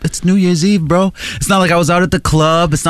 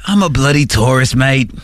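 Australian-sounding tourist; 4 semitones lower than usual. Retold or imitated. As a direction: lower mock Australian accent. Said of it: "like, Australian accents".

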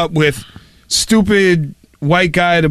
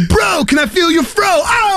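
rapper voice; 13 semitones higher than usual. Retold or imitated. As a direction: hype it up like a rapper. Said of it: "like I kinda rap".

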